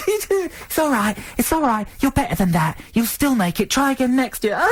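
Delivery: high, sing-song comforting mum voice; hammed-up motherly reassurance; mock consoling mother. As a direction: coo it like a mother soothing her son. Parent consoling boy after show.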